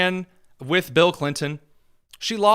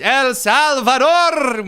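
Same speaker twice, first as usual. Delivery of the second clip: El Salvador rolled with a mock Spanish lilt, louder and higher; 8 semitones higher, 7 dB louder, much slower than usual. Commentator imitating AOC, mock Spanish accent.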